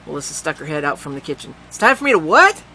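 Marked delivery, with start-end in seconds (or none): 1.7-2.6 put-on high, loud girl's voice, taken aback; a girl who can't believe what she just heard; indignant girl. A narrator's reading. Melissa's indignant question.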